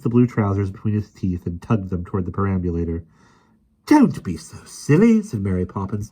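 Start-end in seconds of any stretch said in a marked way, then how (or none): 3.9-5.2 high, slow prim nanny voice; prim rebuke. A narrator's reading. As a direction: put him in his place primly. Mary rebuking the lion.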